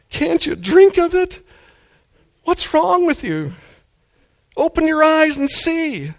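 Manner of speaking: high, slow, pleading cry of frustration; dramatic outburst. Shown as a demonstration. Preacher pleading with a stubborn listener, high impassioned cry. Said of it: "I'm gonna be a little bit dramatic here now".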